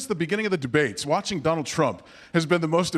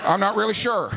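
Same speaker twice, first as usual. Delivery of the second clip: hesitant, clueless ex-president impression; stall, then answer sheepishly; George W. Bush; 2 semitones lower than usual. Former president asked what he has been doing, clueless impression. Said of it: "But with George W. Bush, they'll be like".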